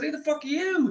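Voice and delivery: high, loud alarmed demand; alarmed outburst. Retold or imitated. Confronting the stranger at the campfire, alarmed shout.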